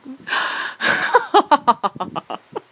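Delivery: loud, high villainess's cackle tumbling downward; evil cackle. Acted. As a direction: gloat with a long theatrical witch laugh. Enchantress gloats over the prince.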